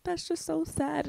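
high-pitched imitation of a crying roommate; tearful roommate. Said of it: "like, crying".

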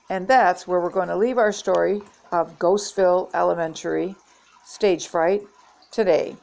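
ordinary delivery

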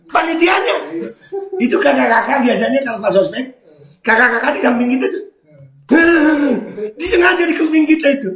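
high-pitched, laughing delivery; helplessly amused voice; laughing while talking. Real, not acted. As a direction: tell it through giggles, high and squeaky. Telling story while laughing.

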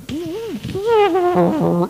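high, loud sputtering trumpet sound; comic broken trumpet squeak; mock trumpet squawk. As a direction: blow a failed trumpet note. Trumpet fails.

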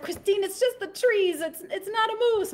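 mock motherly exasperation; exasperated mom. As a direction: insist wearily in a high voice.

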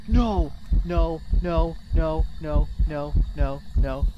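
loud first cry, falling into repeated refusals; panicked string of denials, pitch sinking; panicked protest. Real, not acted. Player cornered by the ghost, panicked protest.

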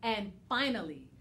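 ordinary delivery, nothing marked

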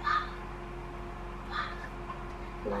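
hushed, voiceless repeat; really quiet. Shown as a demonstration. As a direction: breath-only whisper. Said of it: "Now really quietly".

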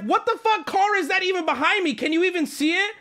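high-pitched retold indignation; feral outburst. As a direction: demand it in a high, affronted voice. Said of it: "I go feral".

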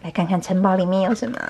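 usual speaking voice

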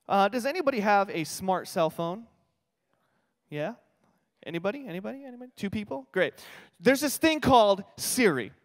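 normal talking voice

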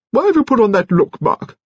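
put-on high-pitched cross old lady; peevish high-voiced grandmother. A narrator's reading. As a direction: scold him in a high grande-dame voice. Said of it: "she added crossly".

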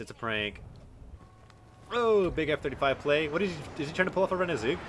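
ordinary talk, nothing marked

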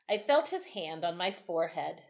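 plain reading voice

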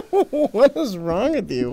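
slow, high, laughing question; laughing disbelief. Real, not acted. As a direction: ask it while still laughing.

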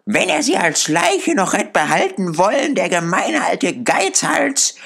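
put-on high-pitched defiant old charwoman; lightly voiced, brazen and challenging; a hint of a coarse, scornful old woman; hostile charwoman. A narrator's reading.

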